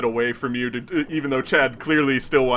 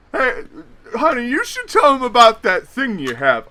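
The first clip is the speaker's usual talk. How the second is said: put-on higher, louder slurred drunk voice; drunk nudge; 10 semitones higher, 5 dB louder than usual. Drunk Chad nudging his fiancée, loud and slurred. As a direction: call to her like a tipsy fool.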